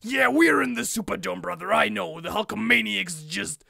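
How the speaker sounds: hammed-up Hogan recovery; Hulk Hogan impression. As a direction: Hogan bluffing through it, brother. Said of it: "he's like".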